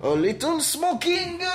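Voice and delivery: high, drawn-out mock announcement; playful announcement. Host teasing a short smoke break, playful high drawl.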